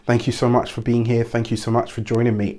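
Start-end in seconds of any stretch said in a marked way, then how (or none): none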